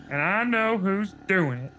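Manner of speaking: Lindy, conspiratorial. A narrator's reading. Sister leaning over her twin, stagey ominous reveal. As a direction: reveal it ominously, not truly whispered. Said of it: "Lindy whispered".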